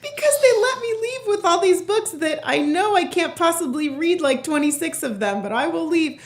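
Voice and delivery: high, laughing through words; giddy, breaking into laughter. Real, not acted. Laughing, gleeful about free books.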